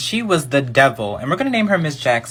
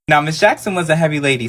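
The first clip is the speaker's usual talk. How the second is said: ordinary talk, nothing marked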